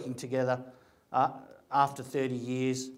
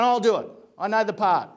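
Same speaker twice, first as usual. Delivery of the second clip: louder and higher, keen yes; eager confident reply; 4 semitones higher, 7 dB louder than usual. Retold or imitated. He tells the casting people he'll take the part, louder and eager. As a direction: jump at the offer.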